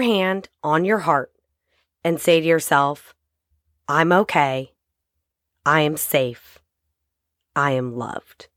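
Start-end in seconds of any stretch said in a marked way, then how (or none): none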